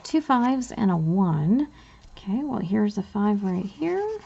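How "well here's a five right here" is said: ordinary talk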